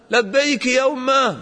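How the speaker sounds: a son answering his distant mother loudly; loud reply. Retold or imitated. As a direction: call back to mother from far off. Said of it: "بصوت عالي".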